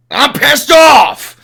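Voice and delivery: slow, high, very loud shout of frustration; angry shout. Real, not acted. Host tells chat his team let him down, shouting angrily. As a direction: bellow it out, fed up. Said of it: "I did my rant".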